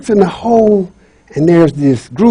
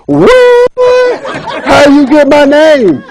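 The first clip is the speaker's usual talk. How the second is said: high shrieked outrage; shocked and mad; 8 semitones higher, slower than usual. Acted. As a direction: squeal like a foiled imp. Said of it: "he gets mad and he starts having his little hissy fit".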